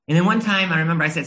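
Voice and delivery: normal talking voice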